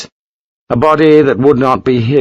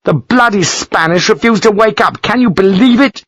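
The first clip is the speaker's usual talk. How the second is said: much higher, outraged disbelief; barely contained anger; 10 semitones higher than usual. Acted. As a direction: let the fury boil over. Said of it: "could barely contain his anger".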